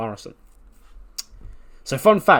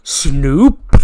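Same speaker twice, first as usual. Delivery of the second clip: loud call from off camera; off-camera shout; 2 semitones higher, 11 dB louder than usual. Retold or imitated. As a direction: call the name out from afar. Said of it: "we just go".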